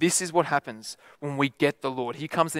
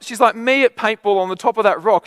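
ordinary talk, nothing marked

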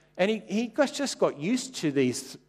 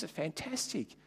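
ordinary talk

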